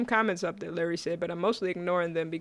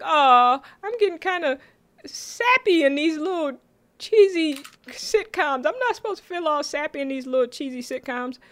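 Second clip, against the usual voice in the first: mock gushing; 8 semitones higher, 3 dB louder than usual. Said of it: "And I was like".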